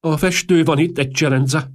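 high, loud, timid announcement; nervous servant piping up to the master. A narrator's reading. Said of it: "mondta a komornyik félénken".

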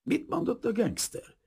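high-pitched irritated query at normal volume; annoyed question. A narrator's reading. American asks the prince for translation, annoyed. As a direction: ask with vexed impatience. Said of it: "kérdezte az amerikai bosszusan".

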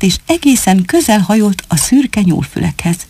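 usual speaking voice

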